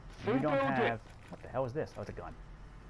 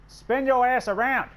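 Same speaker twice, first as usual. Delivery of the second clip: angry shout, much higher and louder; yelling at the tank; 9 semitones higher, 7 dB louder than usual. Real, not acted. Gamer yelling at his useless tank, angry shout. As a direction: bark at the stuck tank. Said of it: "Stop yelling at me, lady".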